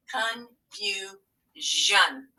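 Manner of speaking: stretched out, sound by sound; very slow word. Shown as a demonstration. Teacher stretching the word for spelling, very slow. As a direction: say it slowly so they hear the ending. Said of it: "which one does it have to be?".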